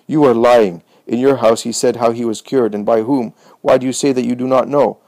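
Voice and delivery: usual speaking voice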